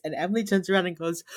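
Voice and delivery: usual speaking voice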